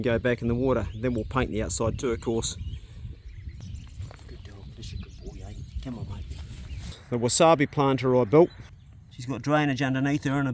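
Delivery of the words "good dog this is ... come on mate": very quiet, low murmur; soft dog talk. Real, not acted. Owner to his dog, softly encouraging. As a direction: murmur praise to a pet.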